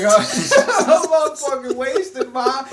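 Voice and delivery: put-on high, loud, laughing drunk voice. Telling his mom he's wasted, drunk and laughing. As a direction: slur it out to your mom, giggling. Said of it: "I leave the house fucked up".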